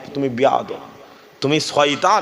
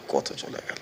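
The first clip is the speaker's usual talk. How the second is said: কত চলে গেল breathed out in a whisper; whispered lament; 11 dB quieter than usual. Shown as a demonstration. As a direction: let it fade to a whisper. Repeats the phrase, then drops to a whisper.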